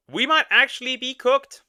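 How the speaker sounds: high, loud dismayed exclaim. Real, not acted. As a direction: blurt it out in disbelief. Dismayed reaction to the clip.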